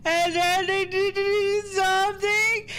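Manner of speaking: high, loud, drawn-out whine; distressed little girl. Acted. Frightened girl recounts the house fire to Sukuna, wailing. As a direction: wail it slowly, words dragged through mock tears. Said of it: "She replies".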